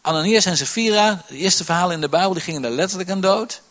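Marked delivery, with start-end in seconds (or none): none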